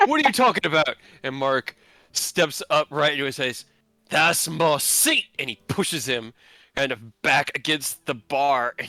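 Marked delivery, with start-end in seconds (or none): 0.1-1.0 put-on high, loud demand at a drunk friend; louder and higher, baffled and angry; angry shout. Bar confrontation voiced. 4.1-5.3 slow, loud possessive yell; loud, slow drunk demand; drunken shout. Acted. Drunk Mark shouting claim.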